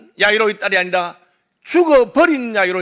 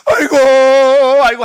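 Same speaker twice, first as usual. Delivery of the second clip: loud high mock lament; mock wailing; 5 semitones higher, 10 dB louder than usual. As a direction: wail aigo like a mourner. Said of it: "막 울고 난린 거예요".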